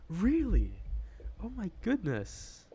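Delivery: high, loud, drawn-out amazement; delighted amazement. Real, not acted. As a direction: react slowly and loudly, truly amazed. Surprised reaction to chat news.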